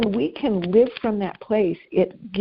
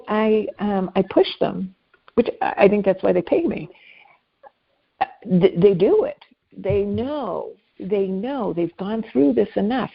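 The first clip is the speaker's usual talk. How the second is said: normal talking voice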